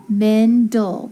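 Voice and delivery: men, then dull, as two separate beats; syllable by syllable. Shown as a demonstration. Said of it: "so that E is short".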